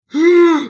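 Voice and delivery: sharp loud mock gasp. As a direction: gasp in shock. Suitor's face draws close.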